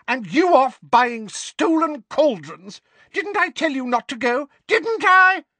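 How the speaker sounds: high, loud accusing questions; furious scolding. A narrator's reading. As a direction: demand it, climbing to a screech. Mrs Figg berates Mundungus.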